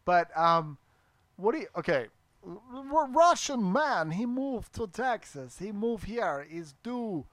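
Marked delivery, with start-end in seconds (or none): 2.5-7.3 slow, higher mock-Russian voice; halting Russian-accented Christopher Walken. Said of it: "it's the Russian Christopher Walken".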